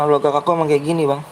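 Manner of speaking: ordinary talk, nothing marked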